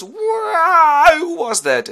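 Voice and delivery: high, loud pained howl; blinded giant's howl. Acted. The blinded Cyclops howls at the sailors, loud pain. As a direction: scream as the eye burns.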